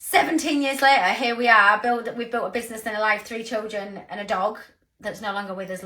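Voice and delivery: ordinary talk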